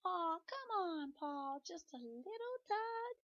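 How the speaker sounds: high, slow, coaxing pigtail voice; urging pigtail. Acted. Pigtail urging Paul to tug it, high, slow and coaxing. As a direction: draw it out sweetly to tempt him. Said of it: "urged the pigtail".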